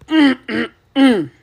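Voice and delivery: short, loud, high frustrated "mm" bursts; angry wordless grunts. Real, not acted. Streamer too mad to finish the sentence, angry grunts. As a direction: hold the rage in with closed-mouth grunts. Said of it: "I am so mad right now".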